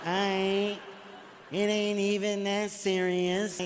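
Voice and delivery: slow, careful tipsy wife; drunk trying to sound sober. Retold or imitated. Comic imitating his drunk wife pretending to be sober. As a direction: fake sobriety, dragging the words. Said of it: "talk like she not drunk".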